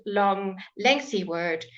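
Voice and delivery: ordinary delivery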